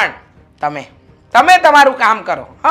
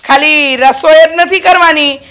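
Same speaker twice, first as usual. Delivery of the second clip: higher, louder, bossy scolding; 5 semitones higher, 6 dB louder than usual. Acted. Mother-in-law to daughter-in-law, loud bossy scolding. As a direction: lay down the law loudly to the new bride.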